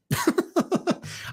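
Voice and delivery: breathless high-pitched laugh; a quick wheezy chuckle; airy high giggle. Real, not acted. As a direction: let a squeaky giggle slip out. Laughs at own joke.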